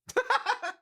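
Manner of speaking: sudden squealing laugh, very high; high-pitched laughing squeal; high squeal of laughter. Real, not acted. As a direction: let a shrill giggle burst out. Burst after dare.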